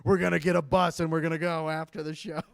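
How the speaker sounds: craving audience. Acted. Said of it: "looking at me like".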